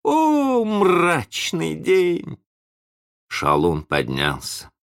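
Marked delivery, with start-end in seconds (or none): 0.0-2.4 high, long-drawn wail; stretched, high-pitched woe; lamenting cry. A narrator's reading. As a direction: bemoan the day theatrically. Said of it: "а другой добавил".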